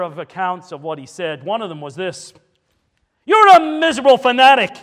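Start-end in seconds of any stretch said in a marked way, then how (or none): 3.2-4.8 very loud, high-pitched denunciation; raised voice. Retold or imitated. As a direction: thunder it at him as a stern elder. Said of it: "said it in a raised voice".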